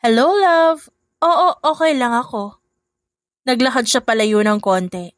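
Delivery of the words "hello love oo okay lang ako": higher, honeyed phone voice to her husband; sweet on the phone. A narrator's reading. Carol sweetly answers her husband's phone call, higher. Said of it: "biglang nag-iba ang tono at naging malambing".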